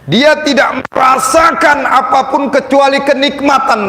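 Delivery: fervent preaching. Acted. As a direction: preach it with fire. Preaching at full force.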